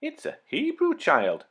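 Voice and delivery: high, surprised cry of discovery; surprised exclamation. Acted. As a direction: exclaim in delight at the find. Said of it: "the princess exclaimed".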